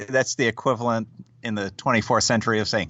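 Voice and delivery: normal talking voice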